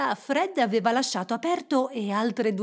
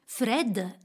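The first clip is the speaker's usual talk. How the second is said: ordinary delivery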